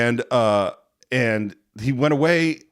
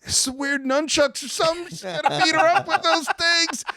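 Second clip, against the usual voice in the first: much higher-pitched imitation of a kid; excited kid. Recalling himself as a kid watching the film, excited kid voice. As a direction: play your younger self, pitched way up in alarm. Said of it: "I remember as a kid going".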